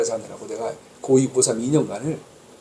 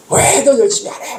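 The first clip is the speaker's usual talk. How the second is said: sharp raised yell at his son; retold angry yell; 7 semitones higher, 4 dB louder than usual. Father yelling at his son about studying, angry shout. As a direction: scold the lazy son loudly. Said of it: "막 큰 소리를 했어요".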